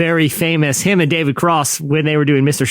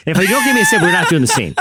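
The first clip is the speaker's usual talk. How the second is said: demanding actor; 4 semitones higher, faster than usual. Retold or imitated. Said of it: "He goes".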